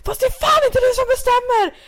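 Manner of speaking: very high, loud retort; re-enacted yelling back. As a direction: act out screaming back in an argument. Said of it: "Jag skrek typ".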